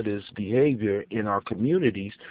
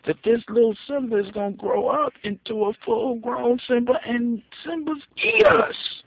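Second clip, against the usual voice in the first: scolding sheep herd; 12 semitones higher, 4 dB louder than usual. Retold or imitated. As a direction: squeal it like a shocked sheep scolding her. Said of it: "the rest of the herd looked at her like".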